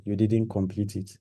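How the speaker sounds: ordinary delivery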